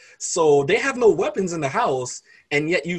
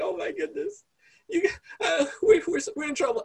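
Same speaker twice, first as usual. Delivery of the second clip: much higher, frightened whimper; scared servant; 13 semitones higher than usual. Retold or imitated. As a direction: squeak it out as if terrified. Scared servant voiced in story.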